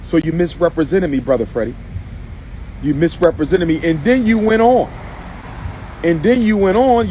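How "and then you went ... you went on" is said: raised indignant voice. Real, not acted. Calling out brother Freddie, raised and indignant. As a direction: hammer it home with rising indignation.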